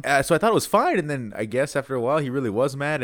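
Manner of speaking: normal talking voice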